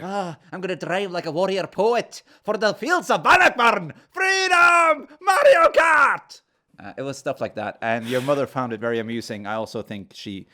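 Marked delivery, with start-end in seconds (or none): 0.0-6.4 high, shouted Scottish war cry; Scottish battle cry. Retold or imitated. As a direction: bellow it like a Braveheart warrior. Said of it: "having this Scottish argument".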